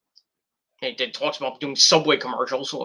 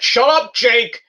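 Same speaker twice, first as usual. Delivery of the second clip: mock yell; 7 semitones higher, 4 dB louder, slower than usual. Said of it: "He's like".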